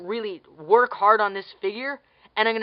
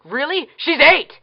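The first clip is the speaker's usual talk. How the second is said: higher, louder, in disbelief; outraged exclamation; 6 semitones higher, 6 dB louder than usual. Real, not acted. Ranting at a movie, outraged she's only eight.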